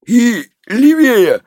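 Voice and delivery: high, drawn-out demand. A narrator's reading. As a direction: stretch it out insistently. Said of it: "потребовала шестая". (протяжно и требовательно)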